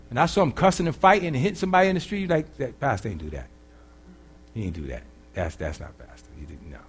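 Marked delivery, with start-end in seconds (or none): 2.6-6.8 low, soft, doubtful murmur; acted member quietly dismissing a rumor; quiet disbelief. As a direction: play it hushed and unconvinced, fading away.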